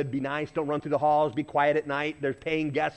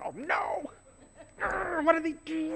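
a higher, worried inner thought; alarmed inner voice; 12 semitones higher, 5 dB quieter than usual. Retold or imitated. Preacher retelling his own thought in the elevator, higher and alarmed. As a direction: fret it out high and a bit under the breath. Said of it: "And I thought".

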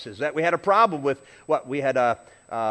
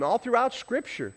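ordinary talk, nothing marked

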